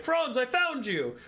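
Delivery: high, slow, overjoyed shout of discovery; the Patriarch thrilled to find Franz; high gleeful greeting; excited Patriarch. Retold or imitated. Patriarch voiced excitedly in retelling.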